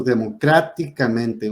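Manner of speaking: democráticamente slowed, leaning on CRÁ; stress on CRÁ. Shown as a demonstration. Teacher demonstrating a sobreesdrújula, democráticamente slowly stressed on CRÁ. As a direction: stretch democráticamente with the weight on CRÁ. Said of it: "en la quinta sílaba de derecha a izquierda".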